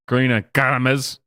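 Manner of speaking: mock Spanish accent on "Gomez"; Karina Gomez. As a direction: bust out an exaggerated Spanish accent for the surname. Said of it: "gotta bust out the accent".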